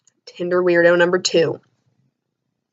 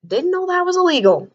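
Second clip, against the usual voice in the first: outraged rant; 6 semitones higher than usual. Real, not acted. Ranting, outraged at the memory.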